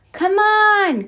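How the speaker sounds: high, loud eager call. Acted. Thumper calls Bambi to the frozen pond, high excited call. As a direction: shout to a friend to come play. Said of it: "called Thumper".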